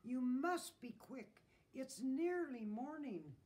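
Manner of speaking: slow, high, loud warning; calling out. A narrator's reading. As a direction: call it out insistently. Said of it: "called the rooster from the gate".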